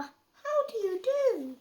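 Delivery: high, loud cheery duck greeting; bright quacky hello. Acted. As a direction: squeak it out like a polite duck.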